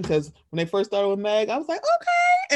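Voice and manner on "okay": very high drawn-out okay; high approving okay; impressed. Retold or imitated. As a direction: coo it in delighted approval. Retold reaction to Meg song.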